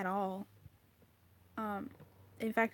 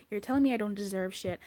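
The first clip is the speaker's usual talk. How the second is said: ordinary talk, nothing marked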